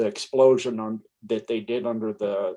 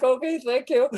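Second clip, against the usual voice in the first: amused reply; 13 semitones higher than usual. Retold or imitated. Answering the dog, amused.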